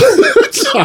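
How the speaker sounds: short, very high burst of laughter; real high cackling laugh. Real, not acted. Guest laughing after teasing the host, laughing.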